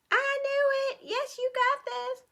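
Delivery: high, bright encouragement; high excited encouragement; excited praise. Real, not acted. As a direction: cheer them on. Encouraging students.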